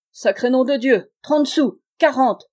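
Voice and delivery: high, loud outbursts of delirium; Jean-Marie's raving cackle. A narrator's reading. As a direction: shout out each bit like a feverish drunk. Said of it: "ricanait le roi de la mer".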